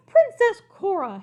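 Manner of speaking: high, loud and slow; shouted. Acted. Shocked king shouts at his daughter, high and loud. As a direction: shout her name in shock. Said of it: "he shouted".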